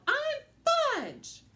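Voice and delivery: put-on shrill little boy yelling; high child yell. A narrator's reading. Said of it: "he really let go and yelled".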